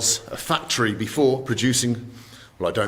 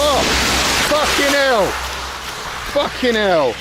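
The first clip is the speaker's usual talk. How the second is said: shocked outburst; 11 semitones higher than usual. Real, not acted. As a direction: yelp of shock at the splash. Car splashes him with slush.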